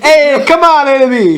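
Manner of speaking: high, loud hammed-up call. Professor calling Ebony to come along, loud and high. Line redone loudly after laughter.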